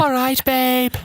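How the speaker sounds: very high Scouse squeak; exaggerated high-pitched Scouse imitation; mock Scouse accent. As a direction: parrot the Liverpool greeting in a high pitch. Mimicking Scouse clip's greeting.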